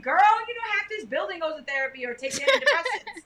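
loud, high-pitched mock outburst; high, loud retold exclamation; blasting it out. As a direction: imitate someone announcing a secret to everyone. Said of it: "like, she blasted everybody".

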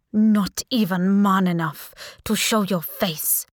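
slow, scornful challenge; put-on imperious voice; defiant Sultan. A narrator's reading. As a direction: challenge him slowly, with royal contempt. Captive Sultan taunts hidden caller.

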